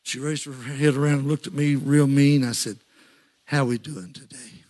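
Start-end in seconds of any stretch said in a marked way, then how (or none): 3.4-4.7 low, slow gentle greeting; calm her down softly; soft, slow pastor's hello; soothing. Retold or imitated. Calming an angry woman.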